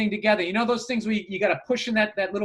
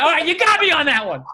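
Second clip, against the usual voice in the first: high, loud mock concession; still yelling; 8 semitones higher, 5 dB louder than usual. Husband concedes to wife mid-fight, still yelling. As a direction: give in while still hollering. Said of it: "eyebrows still up from yelling".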